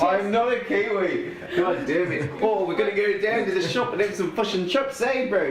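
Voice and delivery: put-on high-pitched joke New Zealand accent; Kiwi accent. Host ribbing Kiwi listeners, exaggerated Kiwi accent. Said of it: "it's just a joke to any Kiwis listening".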